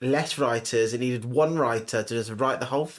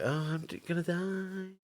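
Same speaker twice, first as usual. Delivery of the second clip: mock whimper; 4 semitones higher, 9 dB quieter than usual. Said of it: "that woman was like".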